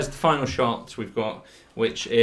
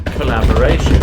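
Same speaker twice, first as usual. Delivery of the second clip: loud announcement; 13 dB louder than usual. Acted. Loud echo of title.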